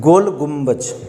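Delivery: "गुम्बज" ending in a j sound, not d; careful pronunciation. Shown as a demonstration. Teacher correcting the monument's name for students, "गुम्बज" with final ज. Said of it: "सही से pronounce".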